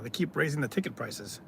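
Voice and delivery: ordinary talk, nothing marked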